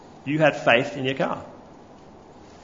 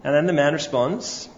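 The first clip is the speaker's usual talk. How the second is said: ordinary talk, nothing marked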